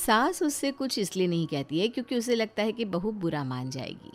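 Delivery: usual speaking voice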